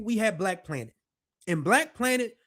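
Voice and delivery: ordinary delivery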